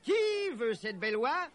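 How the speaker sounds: high market hawker's call; crying out. A narrator's reading. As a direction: hawk your geese to the crowd. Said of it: "cria Robin".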